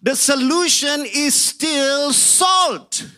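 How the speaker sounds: high, loud preaching, shouting the big lines; full-force preaching. Acted. Preacher rousing the congregation about salt, preaching at full force. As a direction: drive it home to the congregation like a revival preacher.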